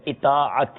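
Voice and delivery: اطاعت starting on short "i": i-taa-at; correct pronunciation. Shown as a demonstration. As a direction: model اطاعت with zer on the first letter. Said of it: "حمزہ کے نیچے زیر ہے".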